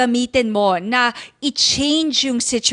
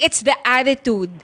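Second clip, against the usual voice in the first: ordinary delivery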